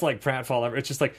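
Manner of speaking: usual speaking voice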